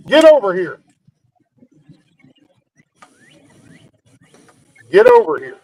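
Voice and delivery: stern command. Real, not acted. Owner ordering dog over.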